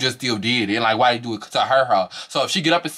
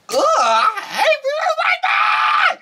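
high, laughing shriek of disgust; exasperated squeal, half laughing; shrieked mock outburst; 4 dB louder, much slower than usual. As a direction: throw it up into a laughing scream. Mocking a castmate, then shrieking.

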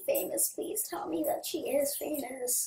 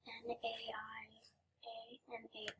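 whispered to herself while checking; 14 dB quieter than usual. Real, not acted. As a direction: hush it, as if reading off the screen. Checking spelling under breath.

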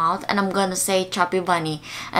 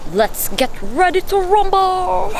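announcer voice; 9 semitones higher than usual. Acted. Hyping up the challenge.

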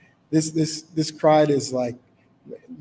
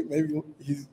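ordinary delivery

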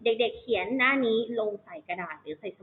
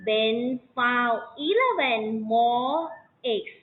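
put-on bright, louder story reading for children; lively read-aloud demo; 3 semitones higher, 3 dB louder, much slower than usual. Shown as a demonstration. Teacher reading the story aloud with the class, louder and lively. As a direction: read it out big and lively for the kids. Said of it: "อีกรอบหนึ่งพร้อมกันนะคะ".

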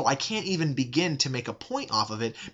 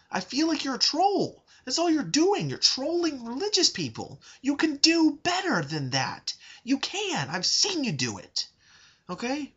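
much higher, exasperated, pleading rant; angry pleading; 8 semitones higher than usual. Real, not acted. Urging the YouTuber to stop trolling, angry high pleas. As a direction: plead hard, frustrated and pitched up. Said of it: "I'm sorry that I got angry".

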